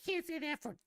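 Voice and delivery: very high, prissy scolding squeak; mock scolding voice. Mocking her rule on swearing, very high and prissy. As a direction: nag it like a prim schoolmarm. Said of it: "she's like".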